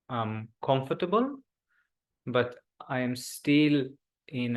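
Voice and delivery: guided meditation. Acted. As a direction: ease the group into stillness. Leads a body scan meditation.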